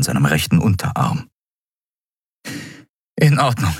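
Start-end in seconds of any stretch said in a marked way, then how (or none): 3.2-3.7 höher, leicht ängstlich; mit zitternden Lippen. A narrator's reading. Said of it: "sagte Endriel schließlich mit zitternden Lippen". (zittrig nachgegeben)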